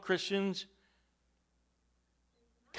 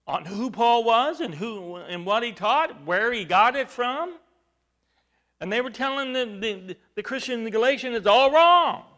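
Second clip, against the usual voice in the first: loud, higher preacher's fervor; preaching; 4 semitones higher, 8 dB louder than usual. Acted. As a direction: drive it home from the pulpit. Preaching at full force.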